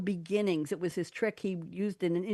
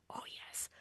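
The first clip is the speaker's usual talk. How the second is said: ordinary talk, nothing marked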